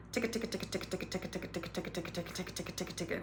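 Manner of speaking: ticka ticka said fast and very soft with a light tongue flick; quick quiet ticka ticka; ticka tongue drill. Shown as a demonstration. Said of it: "you're gonna say".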